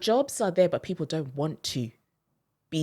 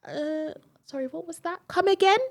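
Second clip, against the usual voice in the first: high, rising puzzled British voice, getting louder; mock British listener; 13 semitones higher, 6 dB louder than usual. British listener to an African caller, high and baffled. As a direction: play the confused Brit who can't follow. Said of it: "That's their favorite phrase".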